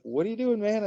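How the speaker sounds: high, eager playful query; excited question. Retold or imitated. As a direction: call out to him with glee.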